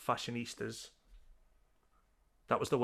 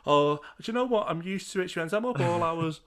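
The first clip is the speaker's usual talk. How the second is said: louder, higher imitation of her reply; breezy celebrity; 5 semitones higher, 6 dB louder than usual. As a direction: play her as loudly unbothered. Voices celebrity's breezy answer.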